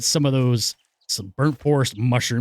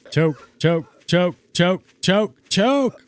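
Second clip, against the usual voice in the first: mock choking; 5 semitones higher, much slower than usual. Imitating choking in smoke.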